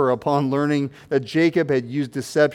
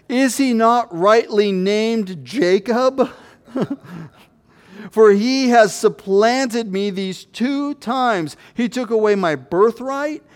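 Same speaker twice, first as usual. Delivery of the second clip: higher, slow aggrieved lament; bitter complaint; 5 semitones higher than usual. Acted. Esau protesting to his father, aggrieved and pained. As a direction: let the hurt rise in the voice.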